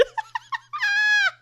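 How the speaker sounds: shrieking laugh; loud high laugh. Real, not acted. Reader shrieking with laughter at the misread. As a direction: lose it in a high cackle.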